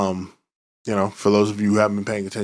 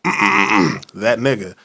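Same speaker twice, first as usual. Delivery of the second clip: quoting someone; 3 dB louder, much slower than usual. Retold or imitated. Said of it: "and I quote".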